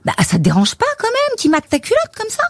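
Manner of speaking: high, indignant disbelief; shocked. Retold or imitated. Shy colleague asks why she tolerates the boss, shocked. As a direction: voice jumps up in outrage. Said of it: "Sophie est un peu choquée".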